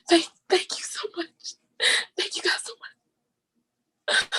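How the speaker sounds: whispered thanks still crying; tearful whisper. Real, not acted. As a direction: thank them through fading sobs. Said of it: "I'm done ugly crying now".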